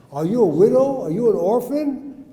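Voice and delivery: ordinary talk, nothing marked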